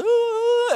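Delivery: very high, loud mock wail; hammed-up falsetto "ooh"; imitated wail. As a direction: squeal like an overwhelmed child. Imitating daughter's wail during lecture.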